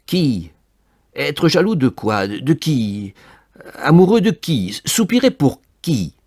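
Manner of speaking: loud, high volley of questions; exasperated questioning. Acted. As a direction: demand an answer in mounting frustration. Exasperated at having nobody to love. (questions exaspérées, fortes et aiguës)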